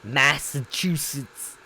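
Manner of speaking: put-on slow, drawn-out Boston accent Massachusetts; old Boston accent. Streamer attempts the old Boston accent for chat. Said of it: "You gotta say it with the old accent".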